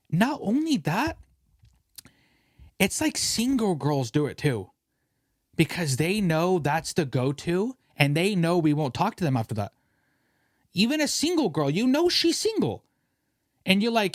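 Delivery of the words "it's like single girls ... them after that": ordinary talk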